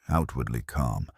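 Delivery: ordinary delivery, nothing marked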